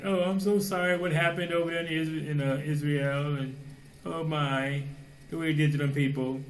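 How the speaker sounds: high, mocking sigh of fake pity; mock sympathetic gushing. Mocking worldly sympathy quote.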